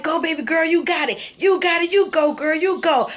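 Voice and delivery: high, loud cheer from the poolside; cheering shout. Retold or imitated. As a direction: yell encouragement to a swimming kid. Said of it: "I'm her biggest cheerleader on the sideline".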